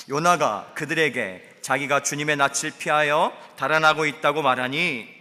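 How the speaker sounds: high, loud fervent preaching; preaching at full force. Acted. Pastor preaching to the congregation at full force. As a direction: drive it home to the congregation.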